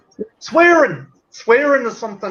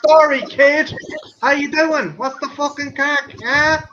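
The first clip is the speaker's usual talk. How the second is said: put-on higher-pitched Limerick accent; 5 semitones higher, slower than usual. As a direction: imitate a local greeting you in Limerick. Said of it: "you go to Limerick, people are like".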